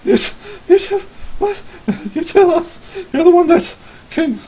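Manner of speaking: high-pitched, stammering villager; frightened stammer. Acted. Acting frightened man confronted downstairs.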